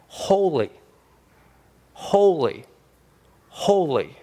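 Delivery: each word set apart by long pauses; slow, solemn proclamation, one word at a time; reverent recitation. Retold or imitated. Preacher quoting heaven's psalm from verse three, reverent recitation. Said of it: "It's the psalm that's sang in heaven".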